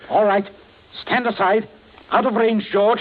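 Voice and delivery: loud, high command before shooting; cold threat. Acted. Villain loudly orders others aside before shooting. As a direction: order it with deadly intent.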